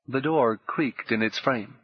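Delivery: ordinary delivery, nothing marked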